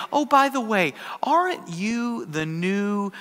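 drawn-out question, building at the end; slow recognition. Retold or imitated. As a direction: spell out the awkward question word by word. Gal slowly recognizes the pastor.

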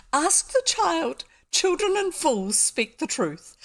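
high, slow, emphatic character voice; acted pronouncement. A narrator's reading. Fat gentleman advises questioning the child, high slow proclamation. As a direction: proclaim it slowly, pitched well up.

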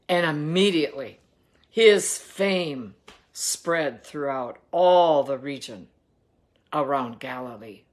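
ordinary talk

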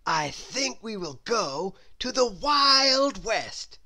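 slow, lightly voiced grown-up; lightly voiced guide; Mister Daydream. A narrator's reading. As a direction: announce it grandly and slowly. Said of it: "said Mister Daydream".

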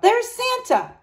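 put-on high excited child's call. A narrator's reading. A child in the crowd calling out, high. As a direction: shout it across the crowd. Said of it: "kids called out".

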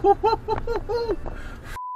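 high laugh. Real, not acted. Laugh after insult.

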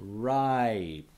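RIPE drawn out, long falling I; going slow. Shown as a demonstration. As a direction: stretch RIPE slowly and clearly. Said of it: "I'm going slow".

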